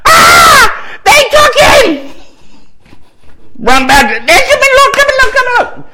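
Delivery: high shouted panicked woman; alarmed cry. Retold or imitated. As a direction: cry out at the empty tomb. Woman finding empty tomb.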